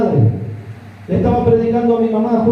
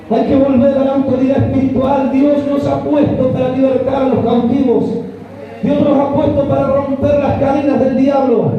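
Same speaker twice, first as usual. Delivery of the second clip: preaching at full force; 3 semitones higher than usual. Real, not acted. Preacher shouting a message of freedom to the congregation. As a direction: preach it hard, building to a peak. Sermon builds to a climax.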